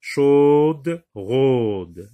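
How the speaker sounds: "Chaude" and "rôde" loud and drawn out, each alone; stress on the rhyme. Shown as a demonstration. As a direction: hold the shared sound in "Chaude" and "rôde". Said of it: "se termine par un même son".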